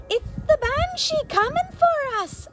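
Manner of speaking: put-on high, loud child's shriek of fear; panicked high cry; terrified scream. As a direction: scream it out as a frightened little girl. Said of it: "Eilish screamed".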